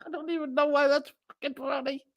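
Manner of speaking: fast, high, giggly; words tumbling out mid-giggle; giggling. Real, not acted. Giggling at chat message.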